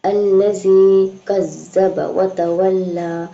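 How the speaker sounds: slow, drawn-out chanted recitation; tajweed recitation, slow and measured; Quran recitation. Shown as a demonstration. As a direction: recite it melodically, stretching each vowel. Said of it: "teacher recites the verse".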